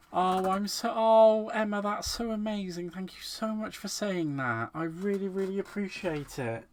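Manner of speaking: overwhelmed by a viewer's kind words, voice lifting; touched and gushing. Real, not acted. Thanking viewer Emma on her live stream, touched and gushing. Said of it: "I can get emotional".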